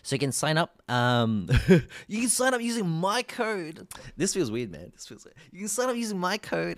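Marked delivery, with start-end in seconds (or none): 2.1-3.9 high-pitched mock ad voice; sponsor plug. Streamer plugging a sponsor code to chat, high promo voice. 5.5-6.8 raised-pitch promo delivery; sponsor plug. Pretend. Streamer retrying the sponsor code plug, high promo voice.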